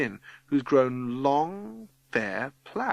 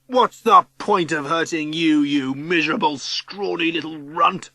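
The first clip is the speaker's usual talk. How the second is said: loud, raised insult slowing at the end; contemptuous shout; 5 semitones higher, 6 dB louder than usual. A narrator's reading. Ryan insulting Matthew instead of hitting him, shouting. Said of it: "Ryan shouts".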